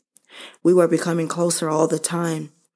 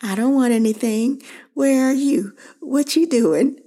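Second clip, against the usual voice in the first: much higher put-on mom voice, sweetly innocent; imitating mom; 9 semitones higher than usual. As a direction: playfully mimic her mother's mock apology. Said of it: "And she would say".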